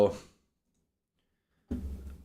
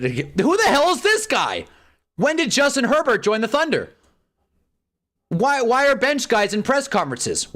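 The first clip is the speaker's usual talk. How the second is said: outraged; 9 semitones higher, 3 dB louder than usual. Real, not acted. Fan ranting at the screen, high incredulous yell. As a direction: demand an answer in disbelief.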